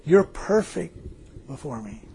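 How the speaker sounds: quiet divine voice, falling away softly; hushed retelling of God's words. Retold or imitated. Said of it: "this voice said again".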